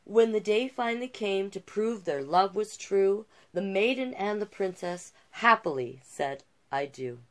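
gentle rhyming read-aloud; storyteller voice for a picture book; storybook narration. A narrator's reading. Reads the fairy tale aloud.

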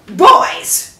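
sudden loud call, pitched high; calling out sharply. Acted. Queen calling her three sons to account, sharply. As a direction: snap the boys to attention.

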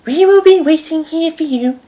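very high-pitched lady's voice; high female character reassurance; Lady Hat. A narrator's reading. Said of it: "said Lady Hat".

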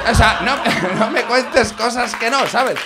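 loud, laughing, slow protest. Real, not acted. (protesta riendo a carcajadas)

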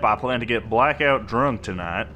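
a hammed-up Southern cowboy accent. Gamer narrating a loot run in a mock Southern accent. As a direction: play it like an old prospector bragging.

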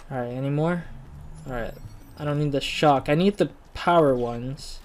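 ordinary talk, nothing marked

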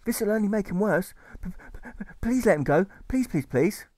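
high, stammered begging; desperate pleading. A narrator's reading. Alfred begs the carer to release the mute boy. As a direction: beg the carer to let the boy go.

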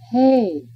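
"hay" said slowly with a clear long A vowel; vowel demo. Shown as a demonstration. Teacher modelling the vowel sound for students, carefully enunciated. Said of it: "pronounced as A, as in English".

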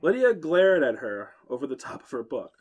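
usual speaking voice

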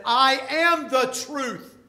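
slow, loud, high proclamation; booming pulpit declaration; proclaiming. Acted. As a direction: thunder out the claim. Preacher proclaims Jesus' claim.

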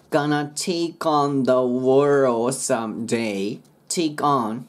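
slow "Gonna take on the world someday"; linked, dropped D. Shown as a demonstration. Teacher modelling the line, slow and linked. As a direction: join "take on" and swallow the D of "world". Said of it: "ここのDは消えます".

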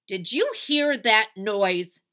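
loud, slow, bossy demand; Eugenia Lincoln. Acted. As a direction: question a sister imperiously. Bossy sister demanding in story.

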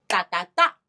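short-short-long 哒哒哒; front-sixteenth rhythm. Shown as a demonstration. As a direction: tap out 哒哒哒, landing on the last. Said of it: "前十六呢是".

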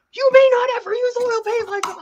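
high, loud mock voice of a rigid stickler; mock rule-giver. Artist mocking people convinced of one certain way, high mock decree. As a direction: put on a high-pitched, hammed-up decree.

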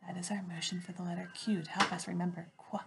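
normal talking voice

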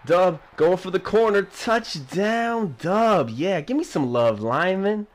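loud touchdown celebration; excited. Real, not acted. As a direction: yell it out in triumph.

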